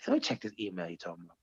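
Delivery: low, fast, soft grumble of a quote; half-asleep low mumble; grumpy mumble. Retold or imitated. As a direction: mutter it like you can't be bothered. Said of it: "I'm like".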